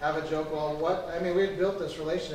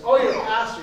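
loud, higher burst of mock surprise; surprised exclamation; 5 semitones higher, 10 dB louder than usual. Neighbor learns the visitor is a pastor, loud surprise. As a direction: blurt it out, imitating a surprised neighbor.

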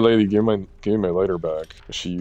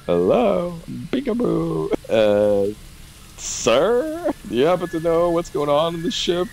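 high, playful sing-song calling out; mock call-out; 11 semitones higher, 3 dB louder than usual. Calling out to a game character, silly high voice.

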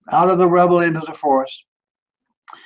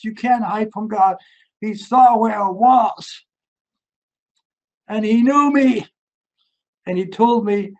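preaching at full force; 4 semitones higher than usual. Acted. Preacher telling the congregation God loves them, raised and fervent. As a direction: press the message home to the listeners.

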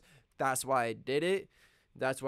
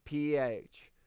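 ordinary delivery, nothing marked

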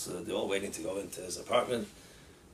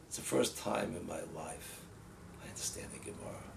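imitating tearful crying; 6 semitones lower, 10 dB quieter than usual. Boy confides in the rabbi after learning, imitating tearful crying. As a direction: voice sinking away, as if tearful. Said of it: "the bracha is crying".

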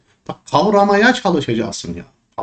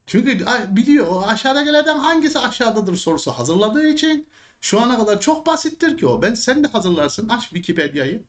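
much higher, sneering parody of lazy questions; mocking; 9 semitones higher than usual. Real, not acted. Teacher mocking lazy exam writers to students, high and sneering.

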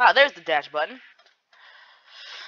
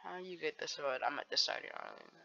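soft, half-whispered reading out; quiet reading; 3 semitones lower, 15 dB quieter than usual. Real, not acted. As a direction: read the chat under your breath. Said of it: "let's try to label conversation this".

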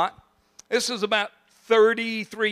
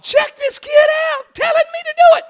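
amused disbelief; 7 dB louder than usual. Retold or imitated. Inner thought, amused disbelief.

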